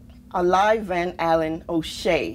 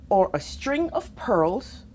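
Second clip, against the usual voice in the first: usual speaking voice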